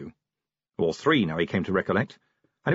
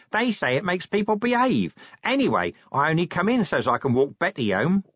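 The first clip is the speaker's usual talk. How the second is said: matter-of-fact, pitched up; chatty Nobby; 9 semitones higher than usual. Acted. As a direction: reassure your sergeant in a perky common voice.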